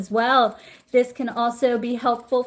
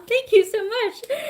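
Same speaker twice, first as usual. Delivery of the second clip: delighted thanks; 11 semitones higher than usual. Real, not acted. Thanking a viewer's kind comment.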